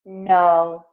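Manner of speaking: ordinary delivery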